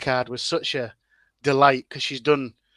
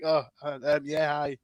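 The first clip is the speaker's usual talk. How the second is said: hesitant, bashful greeting trailing off softer; embarrassed stammer; 4 dB quieter than usual. Retold or imitated. Guest replaying his reply after she focused on the accent, sheepishly embarrassed. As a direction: fumble shyly through a retold hello. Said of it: "embarrassed me. I just went bright red".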